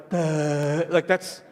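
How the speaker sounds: put-on low, dull "Duh" of a slow kid; dopey child. Speaker mimicking a slow three-year-old, low dopey grunt. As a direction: imitate a toddler who has no answer. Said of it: "they're like".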